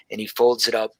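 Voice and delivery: ordinary talk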